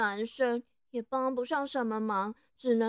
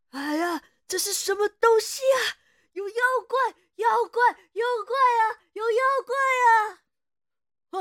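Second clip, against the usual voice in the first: screaming in terror, much higher and louder; terrified scream; 8 semitones higher, 9 dB louder than usual. Acted. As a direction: shriek at the monster. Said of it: "房内有惨叫的声音". (惊恐尖叫)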